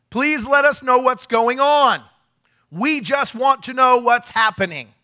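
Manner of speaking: high, loud and slow appeal to the police; retold bullhorn shout. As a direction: call it out slowly, pleading but firm. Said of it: "I said".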